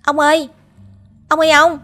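very high, loud call; calling desperately across the marsh; boy shouting for his lost grandfather, high and loud; shouting for grandpa. A narrator's reading. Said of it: "Tôi hét lên".

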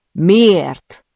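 loud, high yell; yelled in his face. Acted. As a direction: scream the question right into his face. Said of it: "ordítom az arcába". (ordítva, az arcába kiabálva)

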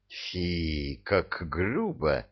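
soft, slow prissy disdain; mock offended. A narrator's reading. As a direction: sniff at the rudeness, prim. Offended devil. (жеманная обида)

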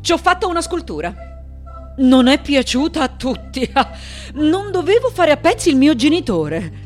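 high-pitched, scoffing mimicry of objectors; mocking indignation. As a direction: pitch it up, sardonic, with a small laugh. Mocks objections to her sculpture. (indignazione beffarda, su toni alti)

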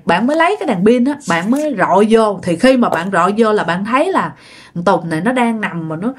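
plain reading voice

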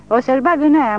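put-on high, loud drunken yell; drunken scream. A narrator's reading. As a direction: scream it as he falls. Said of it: "țipă Grigori". (țipăt de bețiv, foarte înalt și tare)

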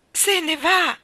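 high, loud cry of alarm; children's cry. A narrator's reading. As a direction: call out after someone leaving. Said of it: "gridarono i ragazzi".

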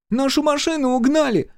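put-on high-pitched alarmed child's cry; child shouting. A narrator's reading. Микки runs to mother about car, shouting. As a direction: shout the alarm as a small boy. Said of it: "крикнул Микки".

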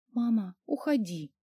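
soft, hushed plea; quiet whisper. A narrator's reading. Daughter asks mother to leave, whispering softly. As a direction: plead under your voice. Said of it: "тихо прошептала я".

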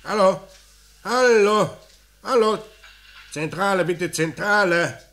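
urgently calling the line back. Acted. Call cut off, calling out. (laut und drängend ins Telefon rufen)

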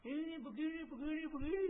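high mock rebuke of an elder; mock harsh scolding. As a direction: imitate a rude youth barking at an elder. Menirukan menegur orang tua dengan kasar. (meniru teguran kasar dengan nada tinggi)

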